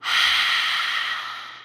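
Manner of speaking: long breathy exhale, fading away; deep sigh. Shown as a demonstration. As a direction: let a deep breath all the way out. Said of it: "exhale it out with a deep sigh".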